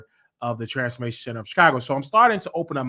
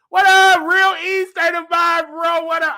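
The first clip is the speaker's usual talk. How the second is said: much higher and louder greeting; shout-out; 8 dB louder than usual. Real, not acted. Greeting a friend's show, loud and high.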